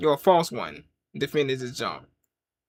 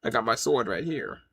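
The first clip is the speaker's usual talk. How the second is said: low, quiet voice of a sworded backer; Caesar figure; 7 semitones lower, 7 dB quieter than usual. Acted. Ancient man on the wall backing a troll, low and quiet. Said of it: "He'll be like".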